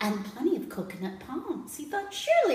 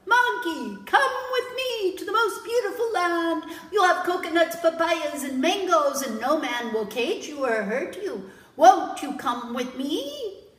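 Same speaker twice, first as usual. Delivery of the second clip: louder, higher coaxing call up the tree; 6 semitones higher, 9 dB louder than usual. Acted. Jellyfish calls up to the monkey, loud and coaxing. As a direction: lure him down with sweet promises. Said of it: "he called up".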